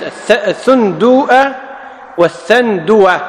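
الثندوءة with a hamza, then والثندوة without it. Shown as a demonstration. To students, الثندوءة versus والثندوة, hamza against none. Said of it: "بدون همز".

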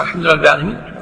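low, hurried follower's recitation; quick catch-up under the imam. Shown as a demonstration. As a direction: rattle the verse off to keep up. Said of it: "أنت بسرعة".

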